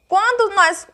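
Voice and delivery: usual speaking voice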